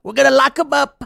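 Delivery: loud, high blustering politician impression; hammed-up aggressive rally threats; Donald Trump. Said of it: "Donald Trump comes out and goes".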